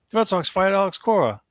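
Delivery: high-pitched retold outburst; worked-up caller, pitched well up; agitated news-breaking. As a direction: imitate a friend blurting shocking news. Retold Frank breaking the shocking news.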